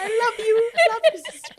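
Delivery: high, loud, laughing call; mock-sheepish, sung-out affection; playful declaration of love. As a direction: gush it to an absent sister. Joking apology addressed to absent sister.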